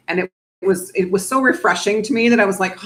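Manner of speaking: usual speaking voice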